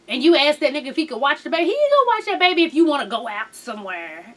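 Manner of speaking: ordinary talk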